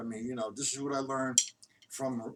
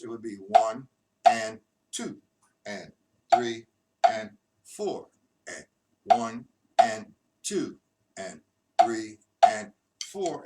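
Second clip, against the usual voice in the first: "one and two and three and four" slow and louder; slow loud count; 7 dB louder, slower than usual. Shown as a demonstration. Teacher counting the lefts-removed pattern, slow and loud. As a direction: count steadily and loudly without the lefts. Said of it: "and I eliminated the lefts".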